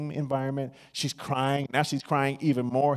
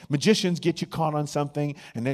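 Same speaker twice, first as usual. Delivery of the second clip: normal talking voice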